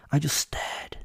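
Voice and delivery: short flat whisper; whispered narration. A narrator's reading. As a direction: breathe it out in a whisper. Silent stare-down with interrogator.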